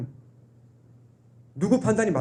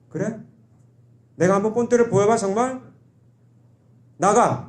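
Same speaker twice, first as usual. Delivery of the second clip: rough angry father; 4 semitones higher, 3 dB louder than usual. Retold or imitated. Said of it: "아버지도 거칠게 나오죠".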